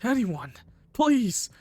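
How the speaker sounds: high-pitched begging for help; acted desperate appeal; desperate plea. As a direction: plead as the footsteps close in. Hiding victim begs for help.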